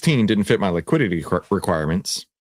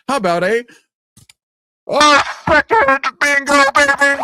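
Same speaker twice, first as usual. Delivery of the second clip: bingo hype; 6 dB louder than usual. Real, not acted. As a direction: belt it like a game host.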